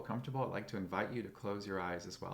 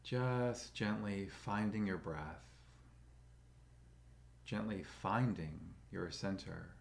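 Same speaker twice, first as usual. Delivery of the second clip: slow, calm meditation guide with long pauses; soothing, even voice, leaving space for breath; guided meditation; 2 semitones lower, 5 dB quieter than usual. Acted. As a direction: guide them gently into stillness, unhurried.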